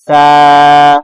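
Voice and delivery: سا said softly, no whistle; gentle سا with no hiss. Shown as a demonstration. Teacher demonstrates سا softly to students. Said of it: "سا نرمی سے ادا ہوگا".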